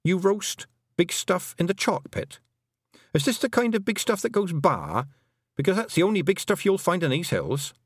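high-pitched, mildly indignant challenge; indignant confrontation. A narrator's reading. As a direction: confront them, pitched well up. Tiffany confronts Rob about sheep.

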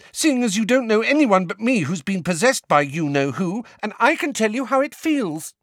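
high, loud, still angry. A narrator's reading. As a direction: press the point hard. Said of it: "said Ginny angrily".

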